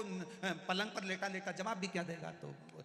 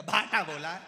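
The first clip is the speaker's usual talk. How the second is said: sharp retort; 12 dB louder than usual. Acted. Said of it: "इसने बोल दिया".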